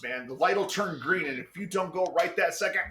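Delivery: normal talking voice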